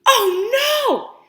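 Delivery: high, loud hammed-up "Oh, no!"; big storytime gasp of dismay; mock alarm. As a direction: play up the shock for the kids. Dramatic read-aloud alarm.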